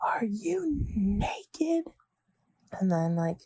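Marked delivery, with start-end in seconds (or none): 0.1-1.9 breathless high whisper, slow; shocked whisper. Retold or imitated. Teacher catching a student undressed, high shocked whisper. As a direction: gasp it out in a shocked hush. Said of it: "she's like".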